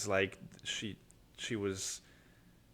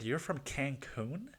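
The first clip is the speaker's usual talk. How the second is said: surprised; 3 semitones higher, 7 dB quieter, slower than usual. Retold or imitated. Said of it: "And I said".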